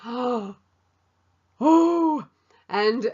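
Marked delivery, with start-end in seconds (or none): 1.6-2.3 emotional oh. Real, not acted. Emotional exclamation recalling God.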